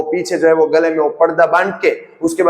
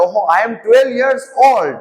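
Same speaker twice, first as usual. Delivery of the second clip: put-on slow, higher, proud twelve-year-old; smug kid; 5 semitones higher, much slower than usual. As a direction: show off like a kid who thinks he's grown. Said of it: "मेरे को लगता है". (घमंडी बच्चे की नकल, ऊँची और धीमी आवाज़)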